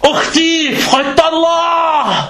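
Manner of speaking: high, slow preachy rebuke; mocking scolding imam. Imam mocked scolding a mother over the mic, high and slow.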